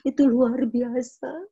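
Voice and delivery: slow words through real crying; crying as she testifies about her late husband; real tears. Real, not acted. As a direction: voice cracking as she speaks. Testimony, voice breaks.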